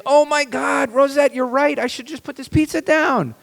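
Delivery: high, hammed-up gushing agreement; sarcastic falsetto thanks to the wife; mock grateful gush. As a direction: overdo the grateful response way up high. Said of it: "we should respond and say".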